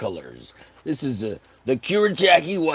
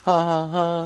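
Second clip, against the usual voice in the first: mock taunt; 4 semitones higher, 3 dB louder, slower than usual. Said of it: "he says".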